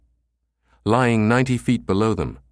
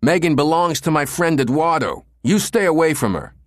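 high, loud punk snarl; a hostile punk yelling a warning; screaming punk; 8 semitones higher, 4 dB louder than usual. A narrator's reading. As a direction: scream it at him like a street punk. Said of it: "The punk screamed at Becker".

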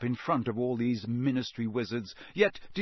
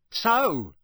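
raised, louder gloating "So"; savage triumph; 8 semitones higher, 6 dB louder than usual. A narrator's reading. Official looking down on Winky, savage triumph. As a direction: crow over the cornered elf. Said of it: "with a kind of savage triumph".

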